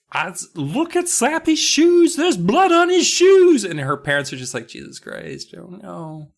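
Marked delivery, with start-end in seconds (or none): 0.1-3.6 high, drawn-out mock wail, building louder; crying girl's outburst. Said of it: "Amy's crying. She's just like". 4.7-6.3 hushed, breath-only mock dismay; dismayed parents.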